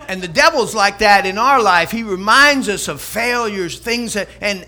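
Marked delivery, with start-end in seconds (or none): none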